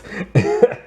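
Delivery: high little cackle of amusement; amused laugh. Real, not acted. Reviewer laughing after her verdict on accents, amused laugh. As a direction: finish with a short, high real laugh.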